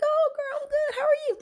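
very high, chirpy social voice; exaggerated girlfriend greeting; high-pitched greeting. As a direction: greet her back way up high. Answering the sing-talker in kind.

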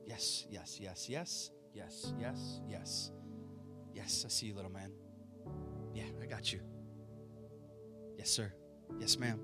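quiet, low affirming yeses; soft murmured acknowledgment. Real, not acted. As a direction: murmur it gently to each person. Acknowledging raised hands softly.

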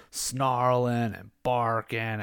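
normal talking voice

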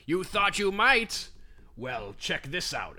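lightly voiced, drawn-out cartoon villain; slow taunt from a mock old-time villain; forties villain. Blob Kelly cornered by Superman, forties villain voice. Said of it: "of course he sounds like that".

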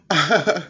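quick self-amused laugh. Real, not acted. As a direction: laugh at yourself. Laughs at forgetting own name.

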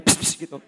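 unvoiced punch hisses; whispered psst jab sounds; jab noises. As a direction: blow air like quick jabs. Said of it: "Itu namanya jab ya".

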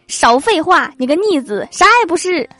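mom scolding. Retold or imitated. Mom scolds son about the cold, mock angry. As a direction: snap like a fed-up mom.

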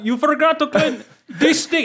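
high-pitched Indian accent impression; imitating the boss. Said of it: "it's very distinct".

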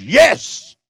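high, bold "Yes"; confident Holy Spirit. Acted. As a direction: answer with sure triumph. Holy Spirit answers the flesh.

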